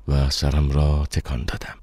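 plain reading voice

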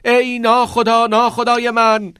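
high, drawn-out ringing call; loud cry. A narrator's reading. Todd calls out to departing teacher Keating, loud cry. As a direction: cry out slowly at full voice. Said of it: "تاد بلند فریاد زد".

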